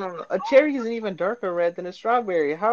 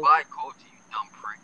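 whispering; 6 semitones lower than usual. Real, not acted. Whispered sign-off insult.

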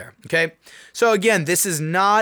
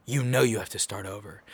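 stern self-talk; 7 semitones lower, 6 dB quieter than usual. Retold or imitated. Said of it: "I'm like".

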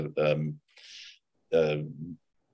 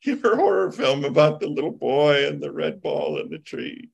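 starry-eyed agreement; 8 semitones higher, 3 dB louder than usual. Retold or imitated. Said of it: "I was like".

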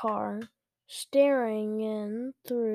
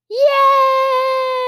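high, loud drawn-out cheer; excited scream; 14 semitones higher, 14 dB louder than usual. A narrator's reading. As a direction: scream it like an overjoyed kid. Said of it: "Luke screamed".

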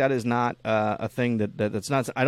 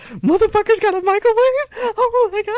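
much higher and louder, thrilled squeal; amazed kid; 9 dB louder than usual. Retold or imitated. Friend mocks host as a kid seeing a microwave, high thrilled squeal. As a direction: gasp at the microwave like a kid with a new toy. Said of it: "You're just like".

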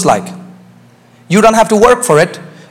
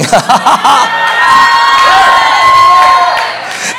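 joyful laugh. Real, not acted. Preacher laughs at own line.

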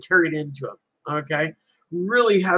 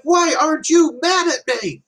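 exasperated demand; 12 semitones higher, 3 dB louder than usual. Retold or imitated. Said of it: "he's like".

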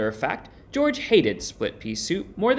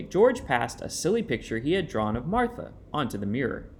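usual speaking voice

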